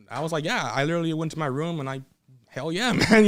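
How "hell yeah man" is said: higher-pitched celebratory cheer with a laugh; retold elation. Wrestler reliving celebrating alone in his room, elated. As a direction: let the held-back excitement burst out briefly.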